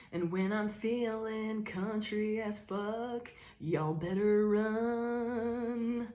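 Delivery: very slow, stretched Southern country drawl; mock-menacing Southern twang, very slow. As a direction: drag out "y'all better run" in a Southern drawl. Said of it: "say you have a really thick Southern accent".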